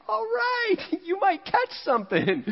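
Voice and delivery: very high, teasing mock wife voice; husband doing his wife's skeptical reply, very high. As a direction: pitch way up and tease him like his doubtful wife. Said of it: "And she's like".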